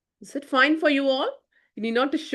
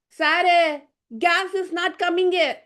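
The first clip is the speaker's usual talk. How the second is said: a lightly voiced eager servant, pitched up; servant Singaram; 7 semitones higher, slower than usual. A narrator's reading. Servant telling his professor the gas fails, lightly raised voice. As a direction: play the earnest cook, a touch higher.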